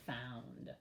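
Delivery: "Found" said softly and low; soft model word. Shown as a demonstration. Teacher softly modeling a sight word. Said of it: "teacher models the word softly".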